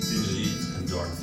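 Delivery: plain reading voice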